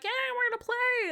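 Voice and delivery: high, bright mock cheer of joy; excited cheer. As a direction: squeal it like a happy kid. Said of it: "They are like".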